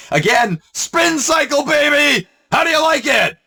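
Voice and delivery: high gleeful shouting taunt. Real, not acted.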